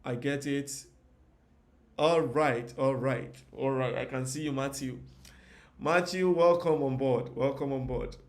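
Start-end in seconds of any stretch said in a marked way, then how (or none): 2.0-4.0 loud, delighted triple all right; excited all right. Real, not acted. Tutor greeting comments rolling into the chat, loud and delighted. 5.8-7.3 higher, loud welcome, slowed on welcome. Real, not acted. Tutor welcoming viewer Matthew from the comments, loud and excited.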